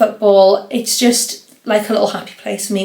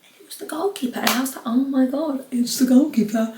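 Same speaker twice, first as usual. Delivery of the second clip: soft, high-pitched mock stage whisper; hushed excitement; 4 semitones higher, 6 dB quieter than usual. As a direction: re-enact a secret gasp of recognition. Retold hushed realisation at next table.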